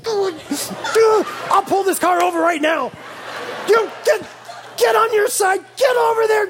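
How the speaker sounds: angry dad. Retold or imitated. Dad threatening kids from driver seat.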